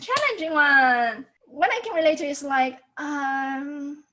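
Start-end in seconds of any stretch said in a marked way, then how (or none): 3.0-4.0 long drawn-out, doubtful um; hesitating. Shown as a demonstration. Teacher relates third tone to English, hesitating. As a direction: stall before agreeing. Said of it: "I'm hesitating to say something".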